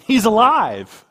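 high, loud, drawn-out declaration; triumphant proclamation. Real, not acted. Preacher proclaiming the resurrection to the congregation, triumphant shout. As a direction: burst out with it at full force, slowly.

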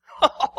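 loud bright laugh. Shown as a demonstration. Preacher laughing off an accuser, loud laugh. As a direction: burst out laughing. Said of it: "You can laugh at him".

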